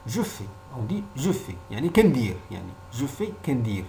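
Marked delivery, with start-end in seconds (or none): none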